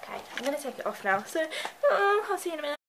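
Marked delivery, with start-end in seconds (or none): none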